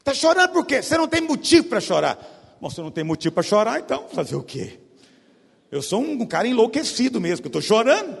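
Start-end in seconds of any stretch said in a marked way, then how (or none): 0.0-2.4 loud, higher mock scolding dad; hammed-up angry father, loud. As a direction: bark it at a crying kid. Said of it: "Ou talvez já falou".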